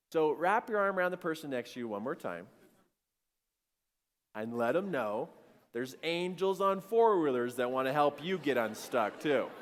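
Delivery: ordinary talk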